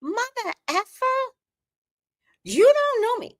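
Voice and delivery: very high, loud furious cry; shrieking outburst. Acted. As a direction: screech it at him in rage.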